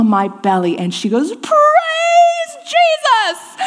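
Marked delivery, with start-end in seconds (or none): 1.4-3.6 very high, drawn-out, laughing cry of praise; joyful scream. Retold or imitated. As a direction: shout it to heaven, overjoyed. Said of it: "Screamed it at the top of her lungs".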